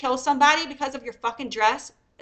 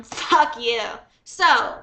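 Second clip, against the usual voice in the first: ordinary delivery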